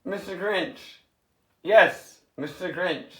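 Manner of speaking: normal talking voice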